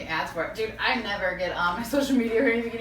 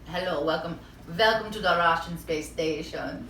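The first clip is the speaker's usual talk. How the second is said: put-on Russian accent; 5 semitones lower, 4 dB quieter than usual. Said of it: "who knows how to do a Russian accent".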